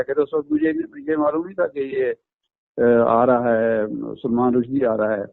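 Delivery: normal talking voice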